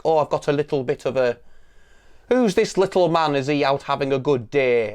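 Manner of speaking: every word carefully sounded, fake Yorkshire; an outsider's stagey Yorkshire accent; mock Hollywood Yorkshire accent. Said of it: "like, Yorkshire accents for the rest of the world".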